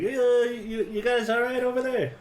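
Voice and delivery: high little gnome voice; squeaky high gnome calling over. Acted. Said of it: "Now give us your gnome voice".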